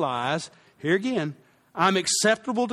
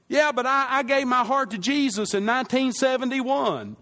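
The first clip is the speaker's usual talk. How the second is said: smug churchgoer; 7 semitones higher than usual. Retold or imitated. Pastor voicing a complacent churchgoer, mock defensive. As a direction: imitate the self-assured member protesting.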